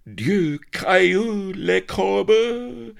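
loud, high, drawn-out French exclamation; aghast cry. A narrator's reading. Happy Dan seeing the blonde, aghast high cry. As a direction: wail it out in horrified amazement. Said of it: "he cried aghast".

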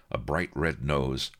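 ordinary delivery, nothing marked

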